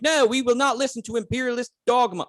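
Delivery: high-pitched, put-on Monty Python objector; defiant commoner. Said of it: "And the guy's like".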